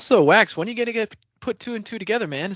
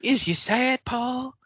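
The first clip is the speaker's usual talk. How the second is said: higher mock bumpkin accent; country bumpkin; 9 semitones higher, slower than usual. Retelling the audiobook voice, a mild country bumpkin accent. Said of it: "country bumpkin".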